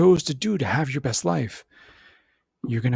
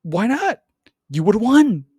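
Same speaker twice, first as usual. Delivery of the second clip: high and louder, God scolding; God rubbing it in; mock-exasperated God; God's voice; 8 semitones higher, 6 dB louder, slower than usual. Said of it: "It's like".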